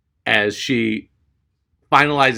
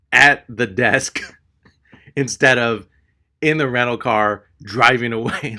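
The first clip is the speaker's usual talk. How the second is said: normal talking voice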